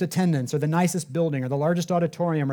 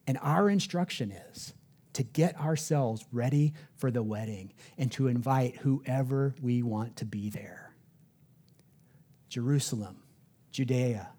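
hushed whisper; 4 semitones lower, 6 dB quieter than usual. Real, not acted. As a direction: drop to a slow near-whisper. Hushed emphasis at sermon climax.

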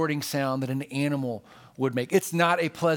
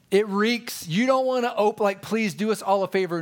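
mock disgust; 5 semitones higher, 4 dB louder than usual.

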